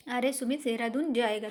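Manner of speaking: ordinary talk, nothing marked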